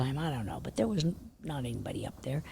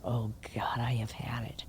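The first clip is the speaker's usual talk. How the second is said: whispered dread; 8 semitones lower, 8 dB quieter than usual. Retold or imitated. Dread on being summoned.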